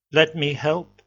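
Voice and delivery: loud, breezy American-sounding offer; cheerful youth barging in with a transatlantic twang. A narrator's reading. Red-haired youth offers Trant help, loud transatlantic voice. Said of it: "said a transatlantic voice".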